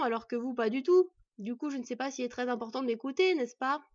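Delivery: sarcasme appuyé, plus fort; moqueur. A narrator's reading. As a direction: se moquer des deux frères. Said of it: "piqué par la moquerie". (le forgeron raille l'elfe et l'enfant, ton moqueur et fort)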